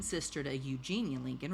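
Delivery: ordinary delivery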